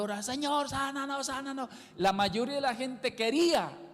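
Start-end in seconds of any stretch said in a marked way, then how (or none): 0.0-1.7 a hint of desperate begging, pitched up; preacher voicing blind men calling after Jesus; mock pleading cry. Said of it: "estaban gritando".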